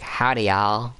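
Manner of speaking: lightly imitated Southern belle accent; a hint of Southern drawl. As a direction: put on a mild Southern accent. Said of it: "like, a Southern accent".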